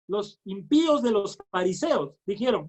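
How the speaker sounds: usual speaking voice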